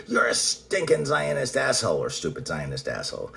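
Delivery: high-pitched mock outrage; retold angry insult. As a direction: imitate an indignant name-caller. Retold insult, acted quarrel.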